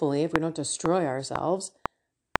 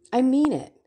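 louder, emphatic insistence; insistent; 4 semitones higher, 5 dB louder than usual. Real, not acted. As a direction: hammer home that she means it. Insisting on the point.

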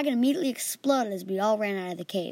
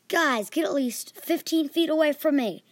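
urgent elf warning, raised pitch; 6 semitones higher, 4 dB louder than usual. Acted. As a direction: warn them off, higher and louder. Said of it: "our new elf friend said".

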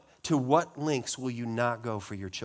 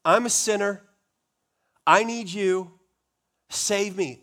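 pleading cry; 6 semitones higher, 7 dB louder than usual. Acted. Voicing the sinner's cry.

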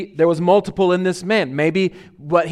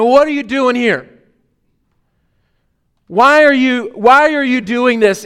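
confronting demon; 4 semitones higher, 5 dB louder than usual. Retold or imitated. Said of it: "a confrontation".

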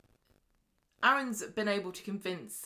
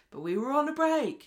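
higher, louder hammed-up Ross from Friends; mock Ross whine; 9 semitones higher, 8 dB louder than usual. [name] excusing himself to Summer, hammed-up high loud whine. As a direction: ham up the guilty boyfriend's excuse, high and loud.

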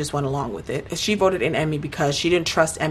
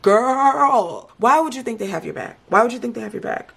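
exasperated disbelief; 6 semitones higher than usual. Pretend. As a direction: scold an absent friend in raised-pitch disbelief. Exasperated mock address to Emmy.

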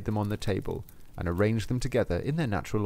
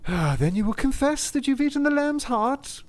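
demanding apostle; 14 semitones higher than usual. Acted. Saint Peter to drowning Lustig, high pressing demand. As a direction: make him confess.

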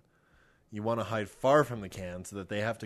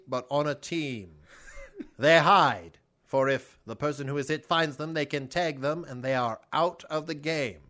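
put-on loud old-timey announcer, pitched up; 4 semitones higher, 4 dB louder than usual. Explaining kick the can to Kevin on request, mock old-timey voice. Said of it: "explain to me kick the can in an old-timey voice".

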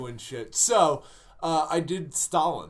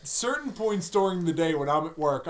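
usual speaking voice

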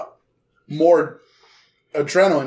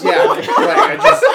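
laughing; 6 dB louder, slower than usual. Real, not acted. Laughing while describing.

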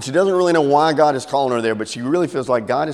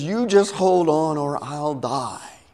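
slow, drawn-out high plea; insistent plea; 4 semitones higher, slower than usual. Acted. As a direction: stretch it out as a warning. Dangling woman's answer.

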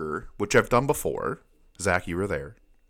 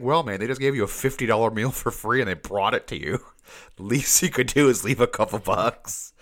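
friend's voice; 3 semitones higher than usual. Retold or imitated. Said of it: "He's just like".

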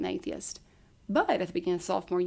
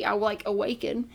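ordinary delivery

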